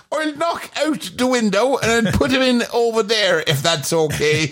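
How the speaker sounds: high, chirpy fitter voice; high workman voice. Acted. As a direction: play a chirpy window fitter. Acting the fitter again.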